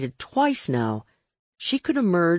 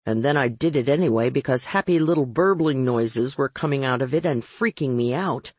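usual speaking voice

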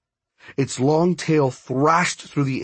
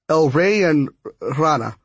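sputtering; 4 semitones higher, 4 dB louder than usual. A narrator's reading. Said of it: "she sputtered".

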